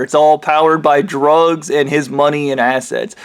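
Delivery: ordinary delivery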